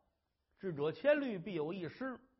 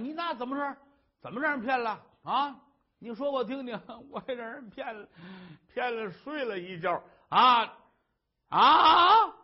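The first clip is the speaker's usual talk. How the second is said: higher husband voice rising to a loud cry; joking husband; 6 semitones higher, 10 dB louder than usual. Acted. Husband joking to his wife, ending in a loud mock wail. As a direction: tease her then burst out at the end.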